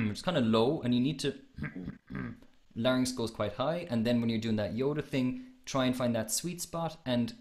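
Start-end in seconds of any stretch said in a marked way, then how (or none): 1.4-2.3 soft demonstrated throat-clearing. Shown as a demonstration. Voice coach showing a student the larynx move, soft throat-clearing. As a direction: clear the throat gently in short bursts. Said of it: "that kind of clearing of your throat".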